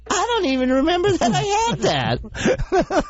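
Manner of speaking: high quavering granny; old lady voice. Retold or imitated. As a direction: play a baffled sweet old lady. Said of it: "She goes".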